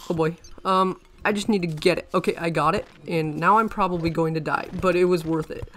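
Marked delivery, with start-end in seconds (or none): 0.1-0.4 hyperventilating over a near death; frantic muttered alarm, louder; panicking. Real, not acted. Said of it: "the equivalent of what's hyperventilating".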